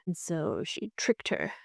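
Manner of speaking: ordinary talk, nothing marked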